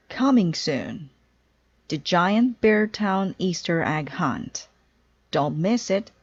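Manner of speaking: billboard announcement. Acted. Narrator reading the town billboard to listeners, announcer style. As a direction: sell the egg hunt like a poster come alive.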